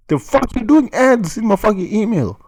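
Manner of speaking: mock angry yell. Real, not acted. Echoing the rant, yelling.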